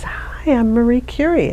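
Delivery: a hint of a returning ghostly woman; Marie Curie. Retelling the voice coming back in the motorhome, lightly imitated spirit. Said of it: "the voice comes back and says".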